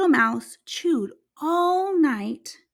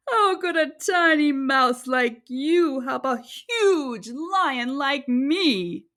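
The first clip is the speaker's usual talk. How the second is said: amused lion; slower than usual. Acted. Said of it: "Still laughing".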